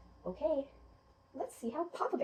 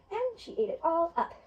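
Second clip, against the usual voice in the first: ordinary delivery, nothing marked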